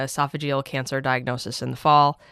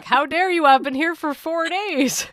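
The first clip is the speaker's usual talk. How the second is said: much higher, slow mock outrage at grandfather; mock furious; 4 dB louder, slower than usual. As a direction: scold him in playful indignation. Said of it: "so mad at him at first".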